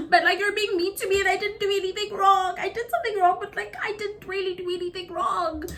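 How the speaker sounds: hammed-up high-pitched protest; mock whiny plea. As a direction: whine it out like a wronged kid. Pleading her own innocence, retold.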